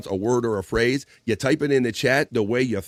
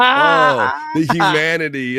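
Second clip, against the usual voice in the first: laughing through a mock-dramatic oh the humanity; cracking up while quoting the line; laughing quote; 5 semitones higher, 6 dB louder, much slower than usual. Real, not acted. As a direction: giggle your way through the famous line. Host quoting the clip, laughing.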